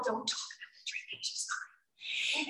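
secretive family whisper; hushed aside. Retold or imitated. Family covering up her drinking, hushed. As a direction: whisper it as a discreet warning.

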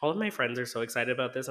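usual speaking voice

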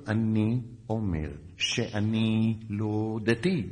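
very slow, drawn-out explanation; stretched, measured retort; slow, deliberate. Retold or imitated. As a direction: spell it out word by word, unruffled. Spells answer out to angry sergeant.

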